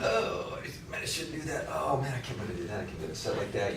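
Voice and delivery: cringing at your own video under your breath; embarrassed self-critique. Retold or imitated. Agent watching his own video, quietly cringing. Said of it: "You're going".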